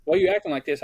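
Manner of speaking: pressing him like an irritated coach; imitating the coach. Coach confronts sulking player.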